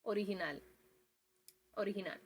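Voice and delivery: original said the Spanish way with an h sound for the g, oh-ree-hee-NAL; Spanish pronunciation. Shown as a demonstration. Quiz item for the class, Spanish sounds. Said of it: "and Spanish".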